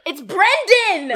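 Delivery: high, loud, drawn-out name; stretched, indignant shout; exasperated correction. Real, not acted. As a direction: holler the correction slowly. Protests at a misnamed name.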